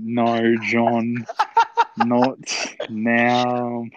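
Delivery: loud, word-by-word telling-off; exasperated, spaced-out warning to a mate; emphatic refusal. Retold or imitated. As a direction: punch NOT and NOW separately. Retold reply to blundering friend.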